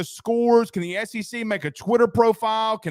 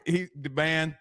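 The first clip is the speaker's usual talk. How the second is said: ordinary delivery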